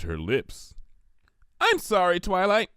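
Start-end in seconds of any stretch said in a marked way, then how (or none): 1.6-2.7 bubbly, high pony apology, cheerful. A narrator's reading. Pinkie cheerfully apologizes to Twilight, high and bubbly. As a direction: beam it out like a sugar-high pony. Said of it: "she said cheerfully".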